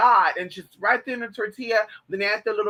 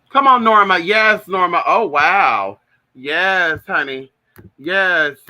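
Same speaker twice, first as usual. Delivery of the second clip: loud, drawn-out summons; calling out; 2 semitones lower, 8 dB louder, slower than usual. Real, not acted. Vlogger calls Norma to come, loud calling voice. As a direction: call Norma over at full volume.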